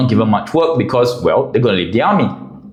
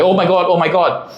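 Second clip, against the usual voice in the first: mild mock panic; 6 semitones higher than usual. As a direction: imitate a panicking driver, lightly. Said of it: "the driver was just panicking".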